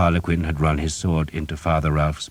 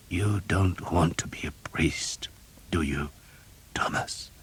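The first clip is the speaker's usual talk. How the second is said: slow, soft whisper with a whimper of pain; a dying man whimpering his question; weak whimpering whisper; 2 semitones higher, 6 dB quieter than usual. A narrator's reading. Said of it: "there was a whimper in his voice".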